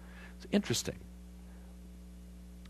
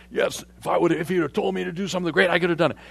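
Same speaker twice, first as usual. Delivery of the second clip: loud, fast, higher bragging; boastful Naaman; 7 semitones higher, 8 dB louder, faster than usual. Retold or imitated. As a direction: puff up your chest and brag. Naaman boasting, voiced by preacher.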